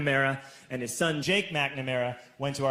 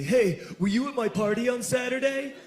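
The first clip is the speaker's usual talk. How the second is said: put-on higher-pitched voice for the kid; imitating a younger schoolmate; 7 semitones higher than usual. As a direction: ask it as the worried party host. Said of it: "And he says to me".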